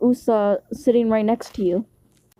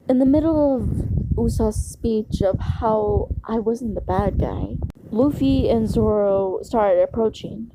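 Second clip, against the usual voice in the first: usual speaking voice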